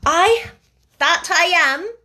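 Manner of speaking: put-on a warm countrywoman answering the boy; Yorkshire mother. A narrator's reading. Mother voiced.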